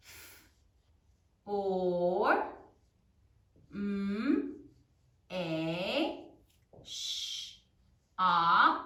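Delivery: low, stretched single sounds, then slow "Information"; E, n, f, or each alone, long pauses; sounding out while writing. Shown as a demonstration. Teacher writes along with class, sounding out. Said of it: "Now say it as you write it".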